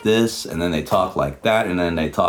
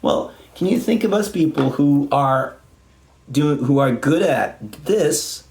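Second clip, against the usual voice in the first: mocking parody; 7 semitones higher than usual. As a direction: throw their words back at them mockingly. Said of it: "mocking the guitarists back".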